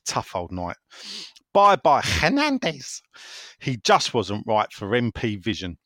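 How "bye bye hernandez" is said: drawn-out sing-song goodbye, higher and louder; mock farewell. Host mockingly waving off a departed player, sing-song. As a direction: wave him off with a cheeky slow bye-bye.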